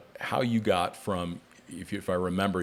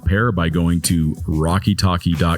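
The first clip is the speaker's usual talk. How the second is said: louder, upbeat host reading the ad copy; sponsor ad read; 2 semitones lower, 7 dB louder than usual. Acted. Podcast host reads sponsor spot to listeners, upbeat ad read.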